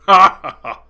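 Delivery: big booming belly laugh; sheik's loud laugh. A narrator's reading. Sheik laughing at the captive's story. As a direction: laugh hard, whole body shaking. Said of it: "The sheik laughed".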